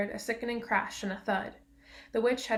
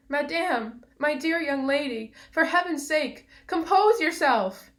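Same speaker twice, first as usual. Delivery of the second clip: high, loud, panicked pleading; trembling scream; 6 semitones higher, 7 dB louder than usual. A narrator's reading. Uncle Andrew begging the witch to compose herself, screaming. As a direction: cry out in alarm, rising to the end. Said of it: "in a sort of trembling scream".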